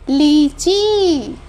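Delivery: लीची said very slowly with ई held long; drawn-out long ई. Shown as a demonstration. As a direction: stretch the ई in लीची. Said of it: "इसको हमें खींच के बोलना होता है".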